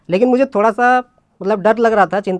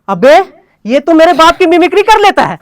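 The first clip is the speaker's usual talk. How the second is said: high, loud, astonished exclaim; shocked; 7 semitones higher, 8 dB louder than usual. Retold or imitated. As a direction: blurt it out in total disbelief. Stunned friend hears dad's voice.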